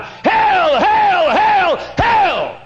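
very high, loud cry of hell, over and over; anguished shout. Retold or imitated. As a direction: scream it out in horror. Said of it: "as he cried".

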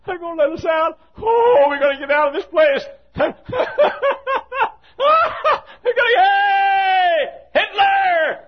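high cries growing into long shouts; wild jubilation. Acted. Damned souls believing they're getting out of hell, jubilant shouting.

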